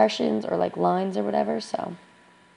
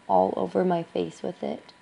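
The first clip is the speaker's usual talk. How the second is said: normal talking voice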